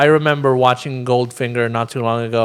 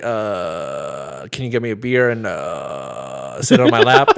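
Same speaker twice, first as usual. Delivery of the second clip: smooth captain voice; 7 semitones lower, slower than usual. Retold or imitated. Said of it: "He's like".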